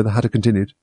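ordinary delivery

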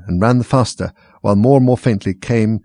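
ordinary delivery, nothing marked

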